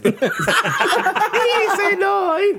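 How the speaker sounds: laughing. Real, not acted. Laughing hard mid-story.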